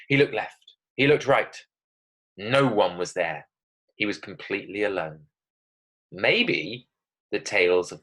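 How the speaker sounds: measured reading with pauses between short phrases; suspenseful story narration. Acted. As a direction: tell it to build tension. Reads her story aloud.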